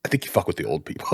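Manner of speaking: low, soft and quick; low, quiet answer. Real, not acted. Answering the co-host's question, low and soft. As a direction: mumble it low and fast.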